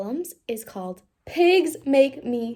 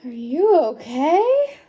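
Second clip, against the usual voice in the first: slow, louder worried question; 4 semitones higher, 8 dB louder, much slower than usual. Acted. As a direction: ask with worried concern. Piggy worried.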